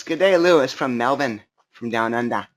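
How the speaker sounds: silly, badly faked Aussie greeting; horrible Australian accent. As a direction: put on a hammy Australian accent. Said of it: "my Australian accent is horrible".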